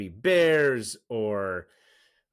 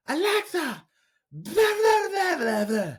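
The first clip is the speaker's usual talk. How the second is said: put-on very high babbling kid voice; toddler gibberish; 4 dB louder, slower than usual. Daughter asks Alexa for animal sounds, high gibberish. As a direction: imitate a small child babbling commands, squeaky high. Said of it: "she'll just gibberish the whole thing".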